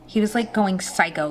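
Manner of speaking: usual speaking voice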